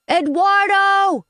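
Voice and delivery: high, drawn-out shout of a name; yelled. Acted. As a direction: yell for help through the door. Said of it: "I yelled".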